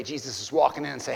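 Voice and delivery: ordinary talk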